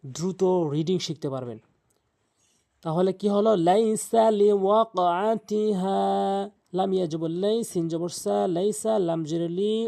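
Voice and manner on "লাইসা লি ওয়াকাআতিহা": slow chanted Arabic verse; slow recitation. Shown as a demonstration. Teacher reciting for students, slow chant. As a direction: intone it drawn out.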